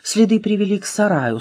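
usual speaking voice

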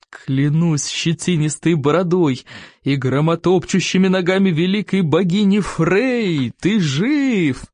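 slow, booming joyous vow; joyful chief. A narrator's reading. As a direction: swear it with gleeful relief. Chief swears in joy.

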